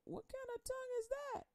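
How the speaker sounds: a bystander squeaking in confusion; high bewildered question; baffled onlooker. Acted. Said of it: "they like".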